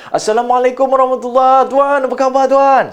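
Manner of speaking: rapid, high, eager greeting; fast talker. Shown as a demonstration. Visitor greets Tuan, fast and high. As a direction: rush the greeting out high and lively. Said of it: "speed of voice ataupun kelajuan suara".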